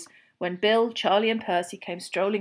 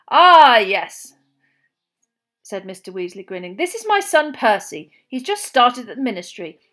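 a hint of a cheerful dad, louder; lightly voiced Mr Weasley; 8 dB louder than usual. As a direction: introduce the family with mild cheer. Mr Weasley introducing family.

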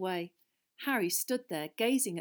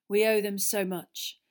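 mock solemn; 3 semitones higher, 11 dB louder than usual. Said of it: "said Fred solemnly".

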